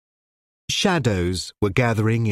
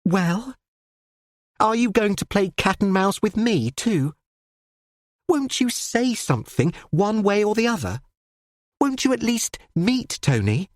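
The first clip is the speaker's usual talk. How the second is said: high, rushed breathless urging; a girl pressing her father for an answer; breathless pleading; 9 semitones higher than usual. Acted. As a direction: daughter begging her father to meet Tony, breathless and high. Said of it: "Constance spoke breathlessly".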